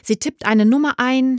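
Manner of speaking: usual speaking voice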